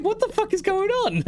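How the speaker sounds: very high squealing disbelief; shocked squeal. Retold or imitated. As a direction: shriek it like the screen is haunted. Retold shock at letters disappearing.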